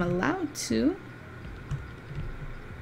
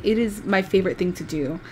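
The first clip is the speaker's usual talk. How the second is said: ordinary delivery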